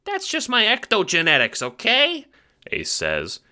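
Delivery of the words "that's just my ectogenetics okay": high squeaky Italian-American chipmunk; fast-talking little mobster, squeaky; Ace, chipmunk wiseguy. Acted. Little guard talks to the Seer, squeaky wiseguy.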